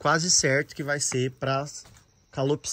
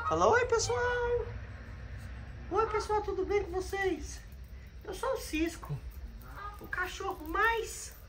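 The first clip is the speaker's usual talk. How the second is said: much higher, soft cutesy voice for the dog; high soft voice of Cisco the dog greeting viewers; dog's voice; 6 dB quieter than usual. Pretend.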